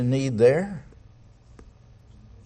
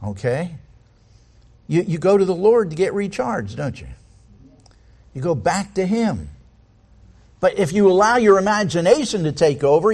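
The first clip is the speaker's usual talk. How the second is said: ordinary delivery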